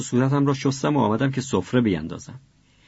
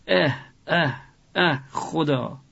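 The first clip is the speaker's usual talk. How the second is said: sobbing; 5 semitones higher, much slower than usual. A narrator's reading. Father sobbing over dead relative.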